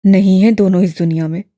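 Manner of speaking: a lightly voiced young man, flat; tight-lipped flat answer. A narrator's reading. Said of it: "सपाट लहजे में".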